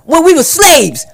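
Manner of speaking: high, loud, slow retort; shouted comeback. Acted. Kid answering when he was chased, shouted comeback. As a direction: holler it back slowly. Acted comeback in street argument.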